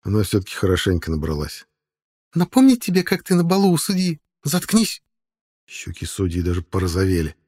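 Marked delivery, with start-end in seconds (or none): 2.3-5.0 tipsy woman. A narrator's reading. Kiria drunk, then Sodia.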